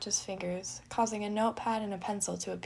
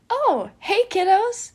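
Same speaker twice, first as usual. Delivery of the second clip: much higher, loud, bright greeting; cheery dad; 12 semitones higher, 14 dB louder, slower than usual. Acted. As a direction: greet the kids like a happy dad. Patton greets twins cheerfully.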